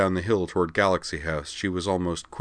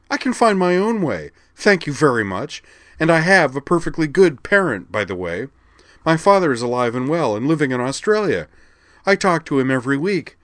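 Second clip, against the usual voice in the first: higher, louder indignant protest; indignant retort; 9 semitones higher, 8 dB louder than usual. A narrator's reading. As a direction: fight back hard. Mia retorts to remembered counselor.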